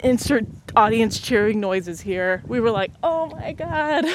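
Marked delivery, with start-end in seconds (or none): 3.0-4.1 high, slow, stretched-out delight; hammed-up relief at the news; mock amazement. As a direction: gasp it out like the big win. Family hears stumps are accepted.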